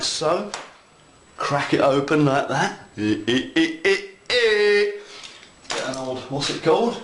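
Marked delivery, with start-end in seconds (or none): 3.5-4.9 grunting. Real, not acted. Cracking the road.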